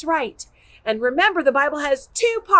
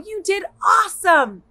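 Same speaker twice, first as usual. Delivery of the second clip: normal talking voice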